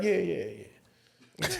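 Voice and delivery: soft, slow, trailing agreement; half-hearted brush-off. Retold or imitated. Rappers dodge his offer, soft noncommittal mumbling. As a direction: let it drag out quietly.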